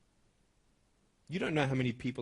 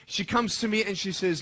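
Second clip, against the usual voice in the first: ordinary delivery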